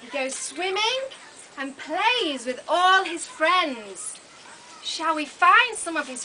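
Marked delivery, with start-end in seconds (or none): none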